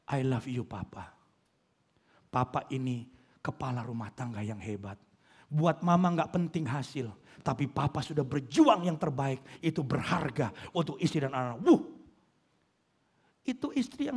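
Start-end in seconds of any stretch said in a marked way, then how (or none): 0.1-11.6 soft, low, loving praise; tender wife. Retold or imitated. Wife encouraging a struggling husband, soft tender praise. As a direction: reassure him gently. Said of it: "Tapi kalau ngomong".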